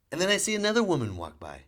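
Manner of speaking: ordinary talk, nothing marked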